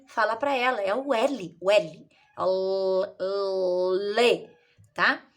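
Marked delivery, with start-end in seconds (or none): 2.4-4.5 a held "L" sound gliding into "lê". Shown as a demonstration. Teacher teaching a letter's sound, held "L" opening into "lê". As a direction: hold the consonant of "L", then release "lê". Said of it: "Pra ficar mais audível pra ela".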